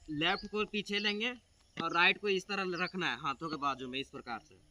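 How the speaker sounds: normal talking voice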